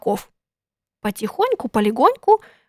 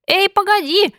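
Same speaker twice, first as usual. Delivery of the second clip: calling out; 10 semitones higher than usual. A narrator's reading. Housewife chasing the runaway pancake, calling out. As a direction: call after someone running away.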